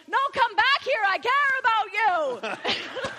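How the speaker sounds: high, mock-shouted plea; imitating overbearing colleague. Imitating him calling her back as she leaves, mock shouting. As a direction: bellow concern in a hammed-up impression, dragging the last words. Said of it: "And he's like".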